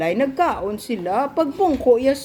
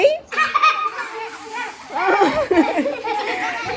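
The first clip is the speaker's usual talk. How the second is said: bursting into high giggles; high laugh; 9 semitones higher than usual. Real, not acted. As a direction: giggle freely at the funny part. Child laughs at story.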